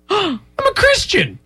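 gasp, then high mock-earnest plea; Shia LaBeouf. Arrested man pleading his faith, gasping high protest. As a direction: overact the shocked innocence. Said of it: "this entire thing as Shia LaBeouf".

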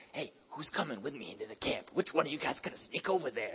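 low, hushed sneaky voice; conspiratorial. Acted. David recruiting his homeboys to sneak into camp, hushed and conspiratorial. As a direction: keep it down, as if plotting.

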